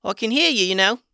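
high, loud squeaky critter voice; guinea pig voice. A narrator's reading. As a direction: pipe up as a tiny cheeky critter. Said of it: "came a voice from the other side of the room".

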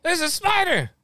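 high loud fake panic; mock spider panic. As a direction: shriek about the spider.

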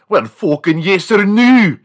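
loud, high Scottish demand; mock Scottish scolding, pitched high; thick Scottish accent. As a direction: bellow it as an exasperated Scottish wife. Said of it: "her accent is so, so thick".

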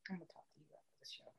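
fast hushed whisper. Real, not acted. Repeating herself to her friend as a whisper. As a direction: whisper it so the mic won't catch it. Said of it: "repeats it whispered".

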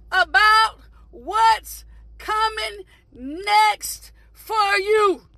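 drawn-out words with long pauses; slow and deliberate. Real, not acted. Preacher warning people won't share your excitement, slow and deliberate. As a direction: land each phrase slowly and emphatically.

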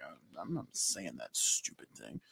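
low, quiet mumbled refusal; grumpy refusal. Acted. Pia refuses the silly greeting, low and sullen. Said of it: "And Pia's like".

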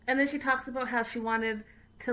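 usual speaking voice

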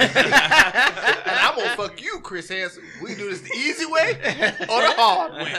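loud, slow, high mock threat, laughing through it; a laughing send-up of a villain's taunt; hammed-up menacing character. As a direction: ham up a cartoon predator's threat, drawn out and loud. Hamming up a South Park threat.

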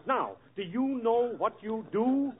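ordinary talk, nothing marked

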